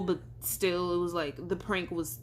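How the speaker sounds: ordinary talk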